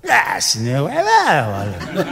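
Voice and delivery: slow, drawn-out mock reply; mocking kid. Kid mocking the director's easy line, slow scoff. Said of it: "Y te va".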